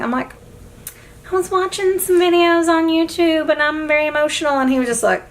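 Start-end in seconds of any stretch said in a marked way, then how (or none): 1.3-4.6 high, strained weepy voice, played up; high-pitched, sustained mock-crying whine; mock tearful explanation. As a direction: imitate yourself blubbering an explanation. Acts out tearful self explaining to deliveryman.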